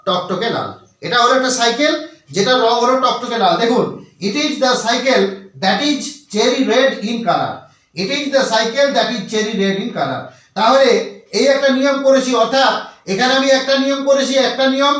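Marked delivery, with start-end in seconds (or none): 4.2-10.2 slow dictation. Shown as a demonstration. Reads example sentence slowly.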